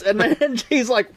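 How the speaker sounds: usual speaking voice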